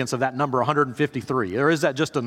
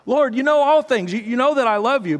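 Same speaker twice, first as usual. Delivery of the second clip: pained insistence; 8 semitones higher, 4 dB louder than usual. Acted. Peter hurt by the third question.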